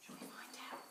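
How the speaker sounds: hushed, teasing suspense; whispered question. Real, not acted.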